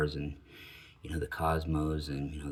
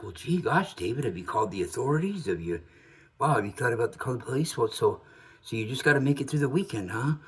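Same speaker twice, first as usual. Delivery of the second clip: higher, hammed-up worried radio host; mock concerned host; 6 semitones higher than usual. Host questioning the frightened caller, with mock concern. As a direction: gush with fake concern, much higher. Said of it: "here's George all concerned".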